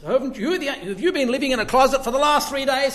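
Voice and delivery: can't believe he hasn't heard; incredulous disciples. Retold or imitated. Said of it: "they say to him".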